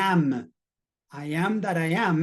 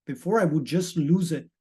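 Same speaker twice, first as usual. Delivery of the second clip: usual speaking voice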